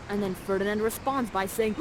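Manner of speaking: ordinary delivery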